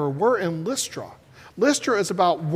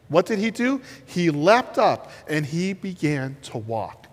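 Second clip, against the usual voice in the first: ordinary delivery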